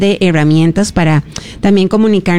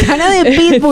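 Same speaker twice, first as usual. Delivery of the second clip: laughing through the words, pitched up; 8 semitones higher than usual. Real, not acted. Host cracking up at her guest's pitbull joke, laughing.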